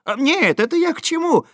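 flustered. Acted. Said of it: "сбивчиво забормотал Хлебоводов".

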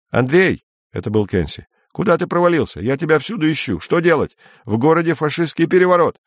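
a frightened colleague rushing; agitated caller. Acted. Frantic caller on phone. (взволнованно, высоким голосом)

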